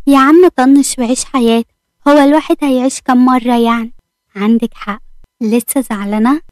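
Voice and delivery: plain reading voice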